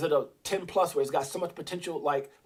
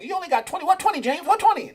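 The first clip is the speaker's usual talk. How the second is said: high, loud nagging heckler voice; squeaky incredulous mimicry of critics; mocking doubter; 13 semitones higher, 8 dB louder, faster than usual. Viewers doubting his twenty coins, high mocking voice. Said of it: "there's gonna be people like".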